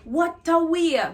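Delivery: "What a way" high and punchy; surprised exclamation. Shown as a demonstration. Teacher demonstrates the phrase as an exclamation. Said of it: "like, an exclamation".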